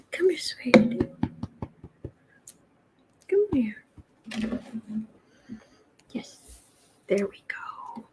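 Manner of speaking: soft unvoiced whisper, cooing to a cat; whispered pet talk. Real, not acted. Owner whispering to her cat while reading.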